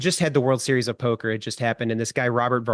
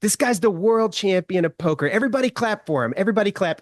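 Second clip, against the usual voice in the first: higher-pitched, commanding celebrity impression; Donald Trump; 7 semitones higher than usual. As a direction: order the whole room to applaud. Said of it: "This is my Donald Trump impression".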